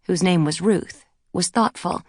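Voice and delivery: ordinary delivery, nothing marked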